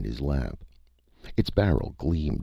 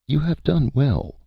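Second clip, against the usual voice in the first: high, louder, polite alien voice; alien creature voice; 13 semitones higher, 4 dB louder than usual. A narrator's reading. The Cytha praises the hunter, high and courteous.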